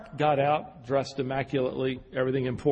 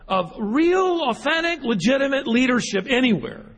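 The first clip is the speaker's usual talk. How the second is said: preaching at full force; 6 semitones higher, slower than usual. Acted. Pastor warning the congregation about a lost world, impassioned build. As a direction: climb to a passionate peak on "authentic, legitimate".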